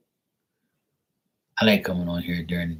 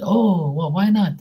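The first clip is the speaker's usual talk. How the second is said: very high, louder mock Filipino voice; Filipino accent; 12 semitones higher, 6 dB louder, slower than usual. Pool guy questioning his customer's refusal, mock Filipino accent. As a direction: pitch it way up in a put-on Filipino accent. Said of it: "making fun of his voice and his accent".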